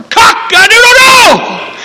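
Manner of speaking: very high, drawn-out shouted cock-a-doodle-doo; full-blast rooster crow. Shown as a demonstration. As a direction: crow loud enough to wake the sleepers. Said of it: "like a rooster that I can remember".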